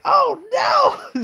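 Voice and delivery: loud high-pitched mock scream; screaming cry. Winnie learns of the affair, screaming in mock anguish. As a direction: wail it out in hammed-up horror. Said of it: "She starts screaming and crying".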